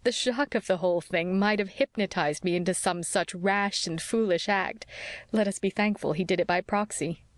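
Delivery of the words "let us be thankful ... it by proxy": plain reading voice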